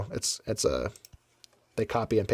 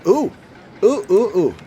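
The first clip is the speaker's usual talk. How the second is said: excited ooh; 7 semitones higher, 6 dB louder than usual. Real, not acted. Spots bumper cars.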